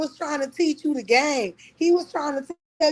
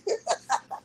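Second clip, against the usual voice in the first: airy laugh; 8 semitones higher, 4 dB quieter than usual. Real, not acted. Airy laugh after the jab.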